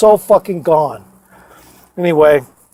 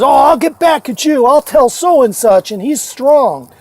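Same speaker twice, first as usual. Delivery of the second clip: higher, slow whiny mock threat; mocking the bully; 7 semitones higher than usual. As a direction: imitate a sulky bully, lightly. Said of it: "And he says".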